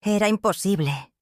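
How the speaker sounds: ordinary delivery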